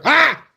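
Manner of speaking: a sudden high yelp of surprise; startled yelp. Real, not acted. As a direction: jolt out a short high "ah". Gimbal suddenly moves.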